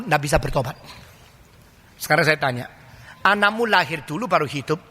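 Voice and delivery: normal talking voice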